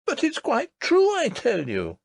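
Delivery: high, pleading through tears; a child near crying, begging to be believed; tearful protest. A narrator's reading. Princess insists to her nurse, tearful and high. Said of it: "The princess burst into tears".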